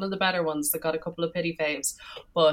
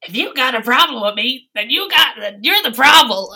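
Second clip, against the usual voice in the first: imitating Dorinda; 5 semitones higher, 9 dB louder than usual. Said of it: "even Dorinda's".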